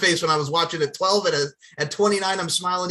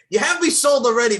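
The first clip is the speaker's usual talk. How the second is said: mock excited; 6 semitones higher, 5 dB louder than usual. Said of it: "I'm like".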